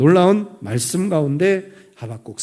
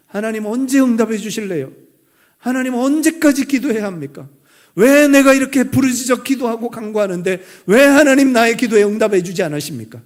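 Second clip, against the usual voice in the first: higher-pitched cry of complaint to God; pleading prayer; 5 semitones higher, 5 dB louder than usual. Acted. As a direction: plead upward, pressing God for an answer.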